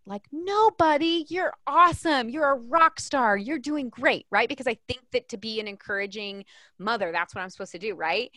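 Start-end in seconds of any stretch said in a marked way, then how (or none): 0.3-4.3 high, loud, over-the-top praise; hammed-up mom hyping her kid; mock gushing encouragement to a toddler; gushing cheerleader mom. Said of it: "my instinct as his parent is to say what".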